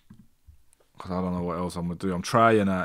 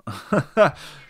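relieved laugh; 9 semitones higher, 7 dB louder than usual. Real, not acted. Laugh after reconnecting.

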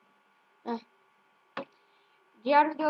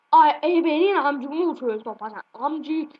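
angry outburst; 8 dB louder, slower than usual. Real, not acted. Teammate misses, outburst.

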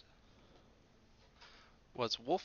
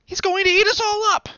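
very high, loud panicked cry; high loud alarmed child shout; 17 dB louder than usual. A narrator's reading. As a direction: shriek the warning at your parents. Dot warns again.

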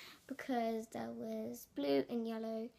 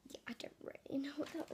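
quick whispered aside; whispering; 7 dB quieter, faster than usual. Real, not acted. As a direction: whisper it to yourself. Aside about unknown loom.

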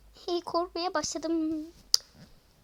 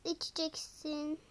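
usual speaking voice

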